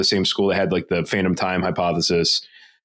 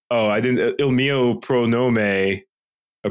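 ordinary delivery

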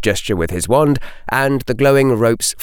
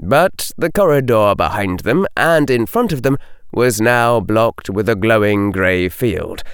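ordinary delivery, nothing marked

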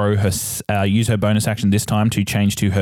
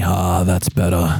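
slow, relieved sigh of a voice; lightly acted, drawn-out relief; voice inside the revolver; 2 semitones lower, slower than usual. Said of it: "you hear the voice inside say".

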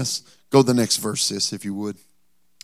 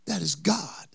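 whisper; 5 semitones higher, 7 dB quieter than usual. Acted. Jesus whispering to the ruler, unvoiced. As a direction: breathe it as a secret.

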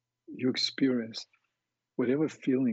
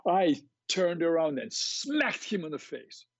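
quoted woman; 6 semitones higher than usual. Retold or imitated. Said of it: "And she said to me".

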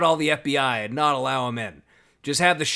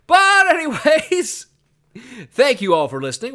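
show outro; 10 semitones higher, 8 dB louder than usual. Acted. Host starts the show outro.

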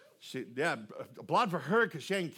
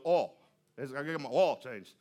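put-on Southern accent; 6 semitones lower than usual. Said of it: "a real Southern accent".

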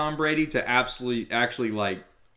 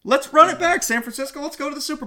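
excited rally cry; 14 semitones higher, 6 dB louder than usual. Real, not acted. Cheering on his team.

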